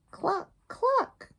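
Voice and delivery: high playful cluck cluck; hammed-up hen cluck. Duck clucks in story.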